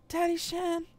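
high loud call. Acted. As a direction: call for Daddy Shen through the quarters. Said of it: "I yelled".

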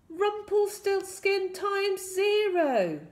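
high, loud, drawn-out shout; desperate spell. A narrator's reading. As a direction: make one last all-out cry. Last desperate try at spell.

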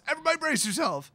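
high, fast shouted order; retold shout. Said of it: "Joyra just shouts".

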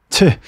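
sharp, high "tch" of disdain; scoff. Real, not acted. Host mocking the cheap boss, quick scoff. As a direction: scoff loudly at the stingy boss.

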